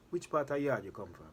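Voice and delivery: put-on porter's question in a light Jamaican accent. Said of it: "I still didn't put on my Jamaican accent".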